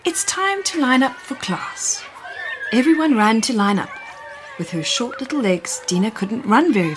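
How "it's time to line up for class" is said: high, sweet and kind teacher calling the class; a gentle high teacher voice lining children up. Acted. Teacher to the children, high and sweet. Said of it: "in the sweetest, kindest voice".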